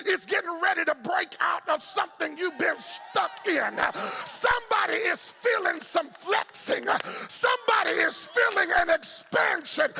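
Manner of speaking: high, driving gospel shout; full-force preaching. Acted. Preacher rouses congregation to break out of the cocoon, shouted preaching.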